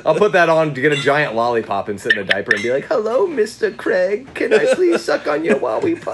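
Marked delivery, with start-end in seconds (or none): 2.9-6.1 high, put-on little boy voice, giggling through it; mock little boy. Pretending to be a little boy for Daniel Craig, giggly and high. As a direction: play a coy little kid in a diaper, much higher. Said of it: "pretend to be a little boy".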